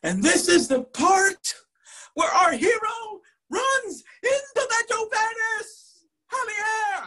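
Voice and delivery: slow, swelling proclamation at a very high pitch; Professor Murphy. Retold or imitated. Professor reading the crucial scene to the class, high dramatic cry. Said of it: "And Professor Murphy goes".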